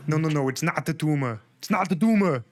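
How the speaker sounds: hammed-up Arnold Schwarzenegger voice; Arnold impression. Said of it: "Arnold's my favorite".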